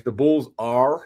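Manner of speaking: slow American accent with emphasized R's. Shown as a demonstration. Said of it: "in an American accent".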